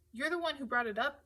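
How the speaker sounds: annoyed retort. A narrator's reading. Matthew retorts in quarrel.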